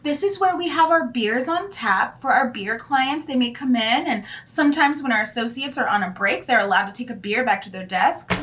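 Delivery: high, imitated office tour voice; put-on guide pitch, starting high and settling; mock agency tour guide. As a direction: show off the perks like an agency host. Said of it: "In one place, they were like".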